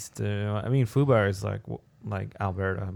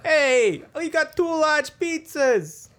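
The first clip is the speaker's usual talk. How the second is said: very high, loud put-on voice, drawn out; hammed-up restaurant character; 6 dB louder, much slower than usual. Restaurant worker calling out a pizza order, hammed-up high holler. Acting out restaurant staff banter.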